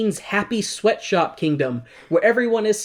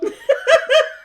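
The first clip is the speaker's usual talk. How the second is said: high squealing laugh; squeal of laughter; 4 dB louder than usual. Real, not acted. Host laughing at her own joke, squeal. Cracking up.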